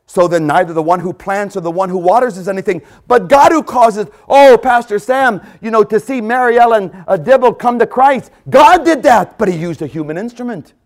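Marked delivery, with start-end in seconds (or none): 3.1-9.4 loud, high proclaiming; fervent pulpit cry; preaching at full force. Acted. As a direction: shout the praise out.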